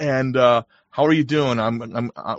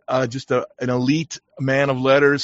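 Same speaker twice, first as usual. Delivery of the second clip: normal talking voice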